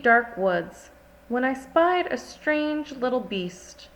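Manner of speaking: ordinary delivery, nothing marked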